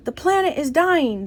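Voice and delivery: yelled slogan. A narrator's reading. Campaigners march through the shopping district, moderate yell. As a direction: chant it out like a marcher. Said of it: "they yelled as they marched".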